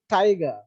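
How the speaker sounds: "tiger" with a strong first syllable and falling ending; stress on first syllable. Shown as a demonstration. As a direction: hit the opening of "tiger", then drop. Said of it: "the first syllable is stress".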